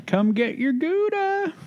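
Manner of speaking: high, slow sing-song call. Real, not acted. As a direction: coax the pet with a high call. Calling pet, high, slow.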